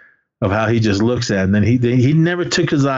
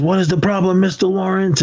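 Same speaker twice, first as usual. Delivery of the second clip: higher-pitched retold challenge; mock challenge; 9 semitones higher than usual. Sensei confronts a distracted student, mock challenge. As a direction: call out a student, half acted.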